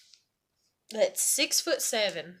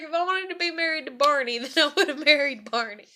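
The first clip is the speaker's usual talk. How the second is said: laughing; 5 semitones higher, 7 dB louder than usual. Retold or imitated. Laughing retelling of teasing husband.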